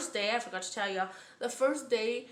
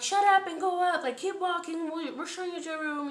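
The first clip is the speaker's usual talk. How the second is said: higher and louder at first, easing off; imitated guard orders; 6 semitones higher, 4 dB louder than usual. Guards moving a screaming inmate along, imitated sharp orders. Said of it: "the guards are telling her to shut up".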